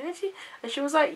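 normal talking voice

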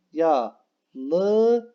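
teacher shows how silly Yalınız sounds; absurd form. Shown as a demonstration. Teacher to pupils, showing absurd Yalınız. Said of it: "Bakın saçma oldu".

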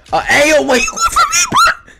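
very high, loud squeak of surprise; high startled disbelief; shocked squeal. Real, not acted. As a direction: squeal "wait, you going for me" in alarm. Surprised at being targeted.

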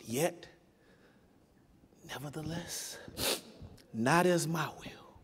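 soft, slow yielding voice; quiet surrender. Acted. Jesus yielding to the Father, quiet surrender. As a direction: let the words go soft and surrendered.

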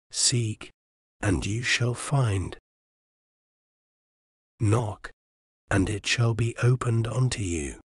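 usual speaking voice

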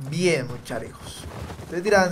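ordinary delivery

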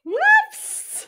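high startled whoops; surprised squeal. Real, not acted. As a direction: yelp it out in surprise. Host fumbles intro, squeals.

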